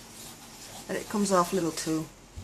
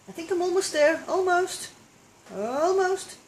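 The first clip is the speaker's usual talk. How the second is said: excited; 9 semitones higher than usual. Real, not acted. Eager self-encouragement, high.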